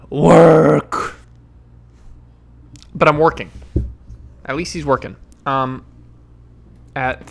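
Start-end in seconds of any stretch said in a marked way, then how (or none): 0.1-1.2 groaning. Real, not acted. Repeating the word work.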